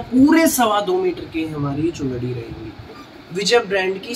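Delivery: usual speaking voice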